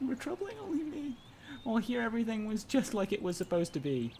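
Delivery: high, whimsical put-on female voice for inner thoughts; bad girl voice. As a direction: float it up like a dreamy girl. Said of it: "the squiggly line is gonna be a girl voice".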